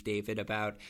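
ordinary talk, nothing marked

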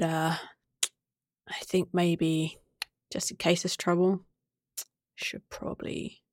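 hushed, hesitant, broken by pauses; very quiet. Acted. Trampal hesitantly suggests staying back with Emil, very quietly. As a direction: start in a whisper and stay very soft. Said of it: "Trampal very quietly says".

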